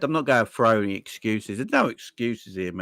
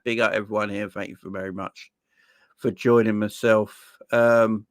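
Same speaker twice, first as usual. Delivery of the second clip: ordinary talk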